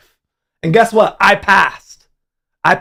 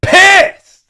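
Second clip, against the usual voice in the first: high, loud outburst; furious yelled pissed; angry shout; 7 dB louder than usual. Real, not acted. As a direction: blow up on the word. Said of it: "I was".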